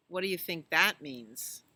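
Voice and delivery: usual speaking voice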